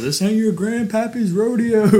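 high, slow hammed-up rodeo announcer. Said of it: "The only thing I heard the whole time was".

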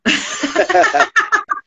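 high bright burst of laughter; sudden high laugh; real laugh. Real, not acted. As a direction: laugh out at yourself. Laughing at own accent.

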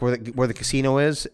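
ordinary talk, nothing marked

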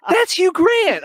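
high-pitched, loud mock astonishment; hammed-up shriek of discovery; amazed disbelief. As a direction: squeal it in stunned recognition. Retelling his amazed reaction to actor.

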